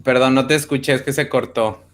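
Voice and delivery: ordinary delivery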